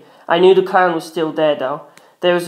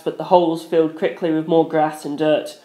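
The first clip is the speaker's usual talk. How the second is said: ordinary delivery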